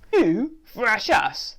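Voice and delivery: slow, loud, high mock growl of a hamster. A narrator's reading. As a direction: growl it slowly in disbelief. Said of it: "the hamster growls".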